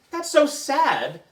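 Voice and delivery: high, slow and pitiful; high drawn-out pity; sad. Acted. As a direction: wail it like a little kid about to cry. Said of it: "said Emily".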